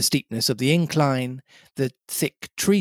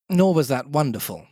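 usual speaking voice

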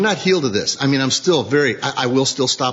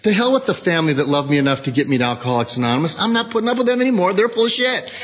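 sponsor mocking; 6 semitones higher, faster than usual. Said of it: "He says".

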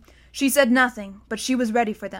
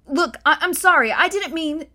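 flustered apology; 6 semitones higher, 5 dB louder than usual. A narrator's reading. Bod apologising in a rush.

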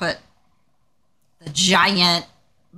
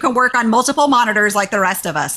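higher-pitched retold objection; exasperated refusal; 6 semitones higher, 4 dB louder than usual. Manager turning down developers' pricey monitor request, exasperated. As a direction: push back like a fed-up manager.

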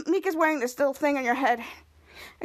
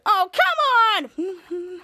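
frustrated wail; 4 semitones higher, 4 dB louder, much slower than usual. Retold or imitated. Kids upset TV cut off.

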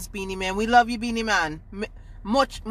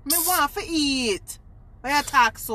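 put-on Jamaican accent; 6 semitones higher than usual. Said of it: "doing our fake Jamaican accent".

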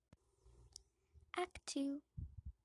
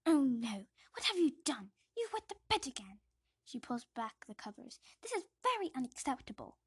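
loud, higher, quicker outrage; disgusted aunt; 3 semitones higher, 9 dB louder than usual. Acted. Aunt finding the wet bed, loud outraged scolding. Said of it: "says Aunt Petunia".

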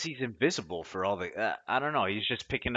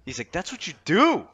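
mock taunt; 10 semitones higher, 8 dB louder than usual. Mocking teammate's taunt.

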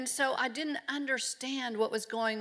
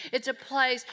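ordinary talk

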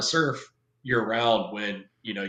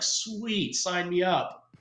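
mock eager; 8 semitones higher, much slower than usual. Sarcastic eager reply to recruiter.

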